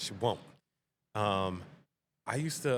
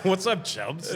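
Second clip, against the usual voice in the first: mock taunt; 9 semitones higher, 7 dB louder, slower than usual. Mock taunt from imagined teasers.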